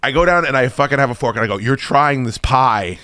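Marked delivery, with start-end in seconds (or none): 1.6-3.0 slow, deliberate command; insistent, drawn-out demand; firm order. Retold or imitated. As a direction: space out every word as an order. Insisting wife eat the pie.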